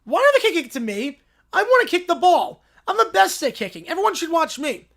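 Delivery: put-on high, loud demanding child voice; bratty little princess. Spoiled princess demanding to kick the ball. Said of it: "Little Princess".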